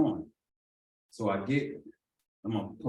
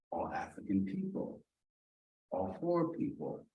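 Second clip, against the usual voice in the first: whisper; 3 semitones lower, 7 dB quieter than usual. Real, not acted. Noticing the other inmates in the tank, whispered. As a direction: hush it like a secret.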